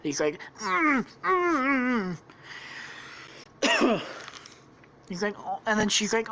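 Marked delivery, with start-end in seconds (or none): 0.6-4.0 high muffled moans through closed lips; wordless high-pitched hummed protest; muffled moaning. Acted. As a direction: moan as if tied up and gagged. Bound captive's muffled noise.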